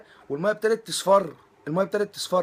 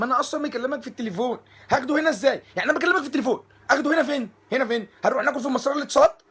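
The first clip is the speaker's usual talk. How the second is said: higher, louder incredulous rant; mock outrage; 7 semitones higher, 6 dB louder, faster than usual. Scene: guest rants at hotel clerk over takeaway, loud mock outrage. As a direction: scold the phone clerk in disbelief.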